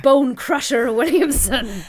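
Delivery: slow, hammed-up pirate boast; pirate name. Introducing her pirate name to listeners, slow mock growl. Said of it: "I am Anna".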